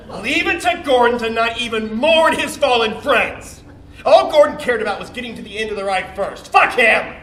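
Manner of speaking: loud, heated outrage at a rival; bitter rant. Acted. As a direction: rail against him, building to a curse. Ranting about hated rival Gordon.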